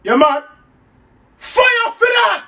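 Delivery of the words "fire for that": high, slow, outraged "Fire for that!"; outraged shout. Acted. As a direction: bellow it in mock horror. Uncle's outraged catchphrase.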